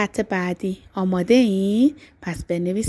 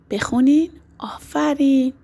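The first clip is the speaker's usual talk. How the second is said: ordinary talk, nothing marked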